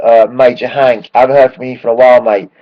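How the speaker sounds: ordinary talk, nothing marked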